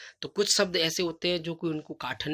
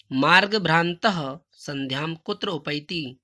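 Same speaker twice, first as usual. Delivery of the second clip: slow recitation; 6 dB louder, slower than usual. Shown as a demonstration. Teacher reads question aloud.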